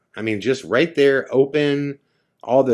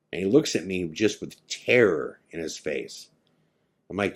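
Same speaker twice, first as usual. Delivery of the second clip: ordinary talk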